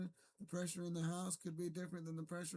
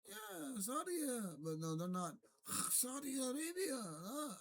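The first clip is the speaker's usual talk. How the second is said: high-pitched, put-on Middle Eastern accent; mock Middle Eastern accent; 8 semitones higher, slower than usual. Mocking how a debater talks, in a high Middle Eastern accent. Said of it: "they might talk like this".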